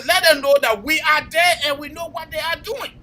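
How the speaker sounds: usual speaking voice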